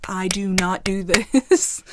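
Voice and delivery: slow, each word set apart and stressed; slow, over-precise emphasis as in therapy; deliberate word-by-word. Shown as a demonstration. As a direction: spell it out firmly, one word at a time. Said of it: "makes fun of me because I talk like this".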